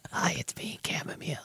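small, hushed character voice; chamomile voice. Acted. Chamomile tea introducing itself, hushed character voice. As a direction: speak softly as a little tea bag. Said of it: "is that the voice?".

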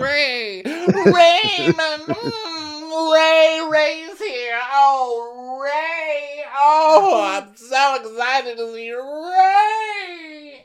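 very high, squealing fan voice; mock gushing. Hosts hailing Ray's arrival, hammed-up ecstasy. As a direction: coo and wail his name, hammed up.

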